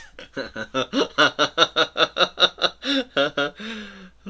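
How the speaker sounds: long, loud high cackle of laughter; real laughing fit. Real, not acted. Artist cracking up at the creature's voice, loud high laughter. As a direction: burst out laughing, high and helpless.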